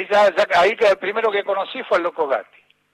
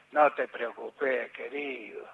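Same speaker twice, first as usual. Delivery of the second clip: low, slow, soft soothing; imitated gentle reassurance; 7 semitones lower, 9 dB quieter, slower than usual. Coach calming a player who wants to quit, softly reassuring. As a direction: soothe him like a fatherly coach.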